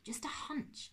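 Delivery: soft unvoiced whisper; a hushed admission to his nephew; whispered aside. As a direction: breathe it out as a confession. Uncle's quiet admission.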